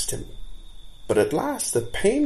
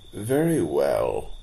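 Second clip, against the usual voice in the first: irritable; 6 semitones lower, slower than usual. A narrator's reading. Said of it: "said Blindweed irritably".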